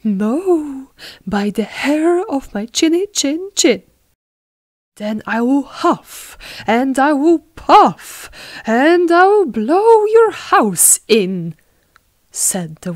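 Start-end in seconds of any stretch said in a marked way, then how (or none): 0.0-3.8 loud, higher sing-song refusal; defiant little pig. Acted. Pig refusing the wolf at the door, defiant. 5.0-11.5 big storybook threat, building louder and higher; threatening wolf. Acted. Wolf warning the pig he will blow, threatening.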